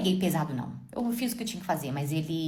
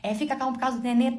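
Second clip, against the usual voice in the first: pleading husband imitation; 6 semitones higher than usual. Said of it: "Ele falava".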